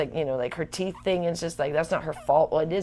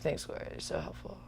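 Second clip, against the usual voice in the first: sarcastic thanks; 9 semitones lower, 15 dB quieter than usual. Retold or imitated.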